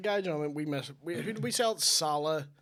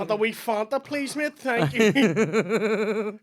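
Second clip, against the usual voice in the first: put-on Italian accent; 6 semitones higher, 6 dB louder, much slower than usual. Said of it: "I switch to the accent".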